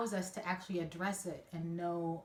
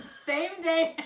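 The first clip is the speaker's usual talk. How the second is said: usual speaking voice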